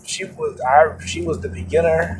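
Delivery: usual speaking voice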